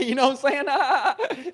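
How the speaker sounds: cracking up while asking; laughing. Real, not acted. Laughing at the memory.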